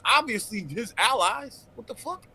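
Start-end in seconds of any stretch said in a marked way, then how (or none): none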